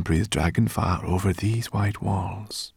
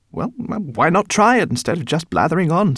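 impatient; 11 semitones higher, 7 dB louder than usual. Acted. Guinevere speaks impatiently.

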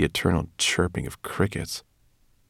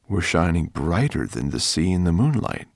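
ordinary delivery, nothing marked

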